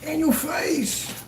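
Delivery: high, slow crowing; high-pitched victory taunt; gloating taunt. Real, not acted. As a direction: rub the win in, stretched out. Gloating over a won case.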